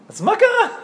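high, loud incredulous question; acting out an exasperated commander, pitch leaping up; so what on earth, high and loud; baffled demand.

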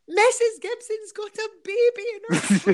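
high, loud shout imitating a wee boy; shouting child. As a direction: announce it to the whole room like an excited child. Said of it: "he shouted to the whole room".

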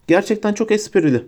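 high-pitched sarcastic mockery; mocking. A narrator's reading. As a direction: make fun of them in a high, sneering voice. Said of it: "dedi alaylı bir ses tonuyla".

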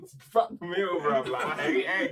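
loud, high mock outrage at the egg; outraged rant. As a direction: rant like it's a disaster, half laughing. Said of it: "and I was like".

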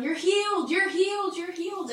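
high, rhythmic calling down; imitated priestly shout. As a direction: call it out in a mock shout, pitched high. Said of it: "priests would shout down".

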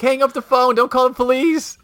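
high, insistent command; urgent neighbors. Retold or imitated. Neighbors plead with him to hang up, high urgency. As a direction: beg him to stop the call. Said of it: "They're like".

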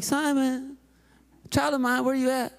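light sing-song parent's call; gentle calling out. Retold or imitated. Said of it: "at first, like".

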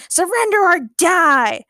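high, loud, slow drawn-out threat; villainous threat. A narrator's reading. Nur resuming his threat to the heroes, menacing. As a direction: stretch out the last word menacingly.

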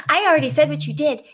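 fast, high mock yelling; heated, quick mimicry of an accuser; enraged teen imitation. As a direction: rattle it off like a worked-up teenager. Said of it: "she's so enraged".

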